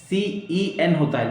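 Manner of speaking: ordinary talk, nothing marked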